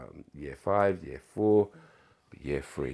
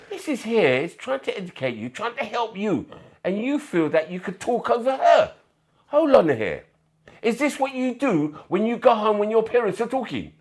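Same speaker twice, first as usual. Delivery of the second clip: mock scolding teacher; 11 dB louder than usual.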